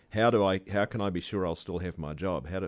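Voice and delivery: ordinary talk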